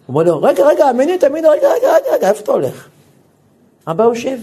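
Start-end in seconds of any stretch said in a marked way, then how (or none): 0.4-2.9 very high, loud calls of wait wait; calling him back. Retold or imitated. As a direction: stop him before he walks out. הרב קורא לאבא לעצור. (קריאה רמה וגבוהה מאוד לעצור אותו)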